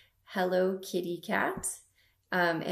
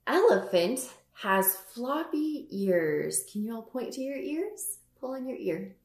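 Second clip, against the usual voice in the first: put-on reading to a toddler; 4 semitones higher than usual. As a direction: read it to little kids. Storytime book read to kids.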